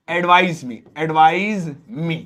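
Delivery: "advise" slowly, with a buzzing z. Shown as a demonstration. Teacher models the verb form slowly. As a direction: stretch "advise" and buzz the z. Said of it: "तो यहां पर एस वाला है".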